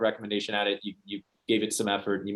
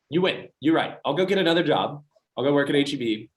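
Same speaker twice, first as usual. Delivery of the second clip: higher, louder eager concession; emphatic give-in; 7 semitones higher, 5 dB louder than usual. Retold or imitated. Son to mom, promising to quit, eager and loud. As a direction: prove to mom he means it.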